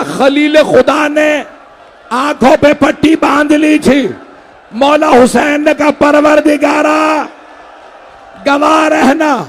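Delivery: grieving preacher wailing in full voice; shouted mourning recitation. Acted. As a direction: cry the scene out to the mourners. Majlis climax, shouted lament.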